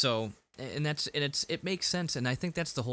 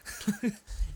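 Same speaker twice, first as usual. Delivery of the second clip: high breathy giggle; 11 semitones higher than usual. Retold or imitated. Laugh after neighbor voice.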